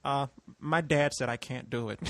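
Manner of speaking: low, subdued retold letdown; imitating sheepish friend. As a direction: drop low and soft to back out. Friend sheepishly withdraws the offer.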